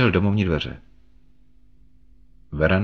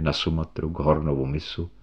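ordinary delivery

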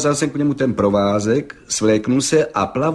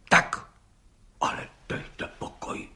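whispered, astonished disbelief; barely voiced, stunned murmur; hushed amazement; 10 dB quieter than usual. A narrator's reading. Judge reacting to the record claim, in hushed amazement. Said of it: "užasl pan soudce".

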